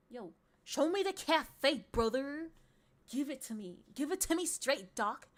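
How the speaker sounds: loud, hammed-up dude voice; mock bro talk. Streamer asking for a cafe informally, loud bro voice. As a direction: demand it like an over-the-top bro.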